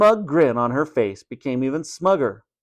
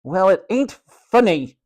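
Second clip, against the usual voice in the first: louder, higher Cockney retort; indignant Cockney boy; 6 semitones higher, 3 dB louder than usual. A narrator's reading. George answering Matron's joke, indignant Cockney. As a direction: answer back crossly in Cockney. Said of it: "he replied".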